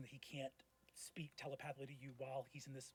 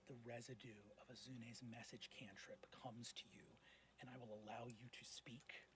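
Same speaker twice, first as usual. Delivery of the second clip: whispered tense narration; 5 dB quieter than usual. A narrator's reading. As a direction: draw the table in with a breathy hush.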